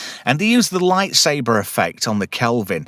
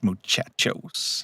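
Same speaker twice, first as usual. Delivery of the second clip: "muchachos" breathed out slow and soft; whispered muchachos; 3 semitones lower, 9 dB quieter, much slower than usual. Shown as a demonstration. Host repeats the catchphrase to cohosts, whispered. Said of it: "talking about ... adios, muchachos".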